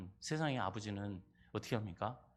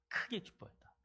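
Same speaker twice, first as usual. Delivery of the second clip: whisper; 8 semitones higher, 8 dB quieter than usual. Shown as a demonstration.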